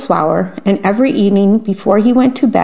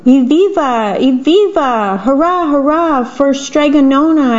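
raised, higher cheer of a happy crowd; 5 semitones higher than usual. A narrator's reading. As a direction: call it out like villagers cheering. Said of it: "everyone shouted".

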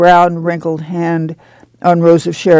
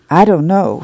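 plain reading voice

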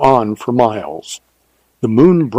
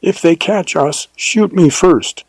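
desperate plea; 6 semitones higher than usual. A narrator's reading. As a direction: beg it in desperation. Desperate plea during chase.

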